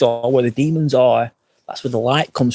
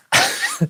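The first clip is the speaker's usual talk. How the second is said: short breathy laugh. Real, not acted. Laughing at own list.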